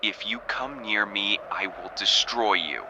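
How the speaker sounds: low, soft menacing warning; low threat. Acted. Recruit quietly threatens the scientist, low and menacing.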